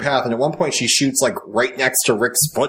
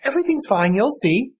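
put-on much higher, eerily sweet girl; creepy child voice; 10 semitones higher than usual. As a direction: soothe like a disturbed little girl.

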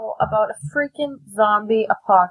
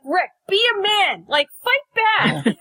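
raised, higher mock shout, slow on fight back; mock yell at Rick; 9 semitones higher, slower than usual. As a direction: urge Rick on like a fan yelling. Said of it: "screaming at him".

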